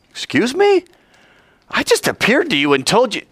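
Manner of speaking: high, affronted voice; indignant God. Acted. God taken aback by Abraham's complaint, high and indignant. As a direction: sound offended.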